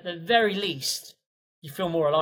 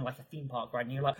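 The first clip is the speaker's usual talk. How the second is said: ordinary talk, nothing marked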